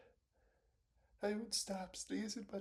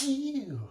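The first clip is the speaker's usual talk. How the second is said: loud sneeze; 4 semitones higher, 11 dB louder than usual. Real, not acted. Sneezing on camera.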